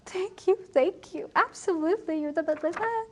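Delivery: high, gushing mock gratitude; overjoyed thanks. Relieved traveler thanking his rescuer, acted happy gushing. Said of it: "And he's so happy".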